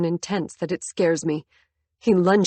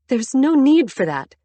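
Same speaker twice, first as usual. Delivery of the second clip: pleading; 7 semitones higher, 6 dB louder than usual. A narrator's reading. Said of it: "I sound like I'm pleading".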